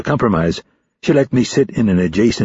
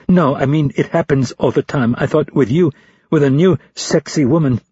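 embarrassed rush; 7 semitones higher than usual. A narrator's reading. Embarrassed man blurts confession.

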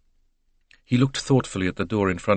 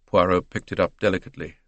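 plain reading voice

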